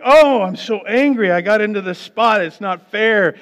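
loud, high, hammed-up indignant whine; sarcastic fake anger; mock outrage. Mock complaint about parking spot.

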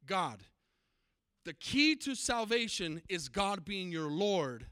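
normal talking voice

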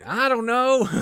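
high put-on voice, comically blank; silly clueless brawler. The angry mob guy answers he has no idea, in a silly high voice. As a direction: shrug it off like a dim goon. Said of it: "and he's like".

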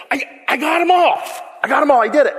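loud, high, gloating shout; triumphant shout. Acted. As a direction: crow over getting them all. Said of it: "And that's when he said".

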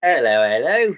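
a jokey British bobby greeting; lightly put-on British accent; mock British accent. Podcast friends trading silly accents, mock British. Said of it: "every time I try to do an accent".

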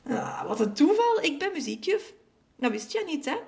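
high, animated exclamation; delighted surprise. A narrator's reading. Neighbour reveals she teaches music, delighted. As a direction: gush over the happy coincidence.

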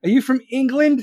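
loud, high mock-excited question; mock gushing listener. Streamer imitating listeners hearing a foreign caller, mock excitement. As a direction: blurt it out like an over-impressed fan. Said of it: "they're gonna be like".

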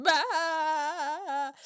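high, loud fake yell; mock scream. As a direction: let out a silly cry. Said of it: "like, him just".

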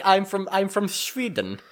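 high, slow mock foreigner saying where they're from; a touch of foreign tourist introducing themselves. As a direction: lightly play a proud foreigner naming a country. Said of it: "'cause it's like".

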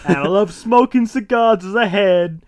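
put-on slow, high old-man rant; cranky old man. Dad listing his vices, cranky old man. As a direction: stretch the boast out grumpily. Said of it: "I'm cranky".

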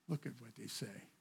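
ordinary talk, nothing marked

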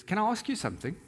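high-pitched, polite parrot request. Acted. Parrot asks the woman a question after apologizing, very politely. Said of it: "Very politely".

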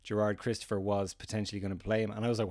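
ordinary talk

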